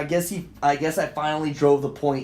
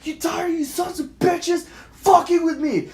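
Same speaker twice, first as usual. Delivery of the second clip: high, angry re-enacted shout; retold screaming; 13 semitones higher, 4 dB louder than usual. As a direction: yell it at everyone around. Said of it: "I'm screaming and yelling".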